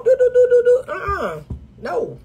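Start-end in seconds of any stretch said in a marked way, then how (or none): 0.0-0.9 high silly do-do-do noises; mock monkey scrolling. Coach imitating a monkey scrolling, in high silly sounds. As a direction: make dumb tapping sounds. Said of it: "A monkey could scroll and go".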